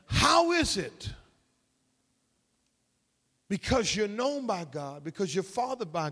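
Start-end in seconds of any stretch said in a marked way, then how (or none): 0.0-1.1 "How is it" drawn out slowly on a high pitch; slow, emphatic "How is it"; emphatic question. Shown as a demonstration. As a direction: lean long and high on "How is it". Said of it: "It's an emphatic in the Greek".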